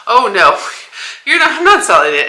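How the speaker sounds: high, loud flustered no; excited protest. Retold or imitated. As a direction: blurt it out in alarm. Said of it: "I was like".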